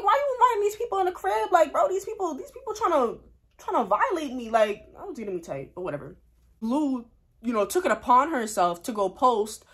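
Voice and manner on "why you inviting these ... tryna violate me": high-pitched indignant rant; protesting to mom that these people are disrespecting her; outraged complaint. Retold or imitated. Daughter protesting to her mom about inviting rivals, outraged high rant.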